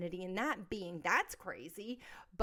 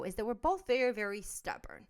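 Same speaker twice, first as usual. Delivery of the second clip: ordinary talk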